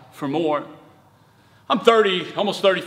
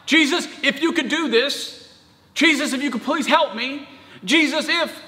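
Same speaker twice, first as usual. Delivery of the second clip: doubtful plea; 6 semitones higher than usual. Acted. Voicing the doubting father's plea.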